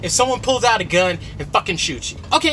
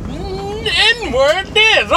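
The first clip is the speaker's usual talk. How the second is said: higher, louder mock of a friend's joke; imitating friend Evil; 13 semitones higher, 4 dB louder, much slower than usual. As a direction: toss off the friend's line in a hammed-up voice. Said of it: "He said".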